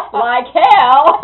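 loud laughing protest. Real, not acted. Teller laughing back at her friend, loud. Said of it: "you're having too good of a time".

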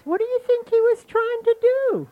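very high, loud innocent questioning; feigned amazement. Acted. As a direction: ask in wide-eyed disbelief, high and loud. Disguised rabbit feigns innocent amazement.

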